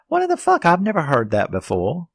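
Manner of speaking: squeaky-high disbelief; incredulous outburst. Retold or imitated. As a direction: shoot way up in pitch, baffled and indignant. Acts out own baffled reaction.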